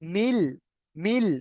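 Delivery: "মিল" with a held l, no n; ends in l. Shown as a demonstration. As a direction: close "মিল" firmly on l. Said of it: "মিন পড়ছি না কিন্তু".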